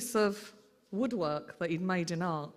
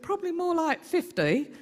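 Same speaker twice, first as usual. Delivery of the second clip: mocking; 10 semitones higher, 4 dB louder than usual. Aunt mocks the guest count.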